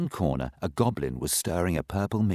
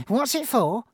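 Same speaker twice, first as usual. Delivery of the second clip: much higher and louder, eager; high curious voice; 5 dB louder than usual. Acted. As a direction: ask it with childish eagerness. Saucepan voice.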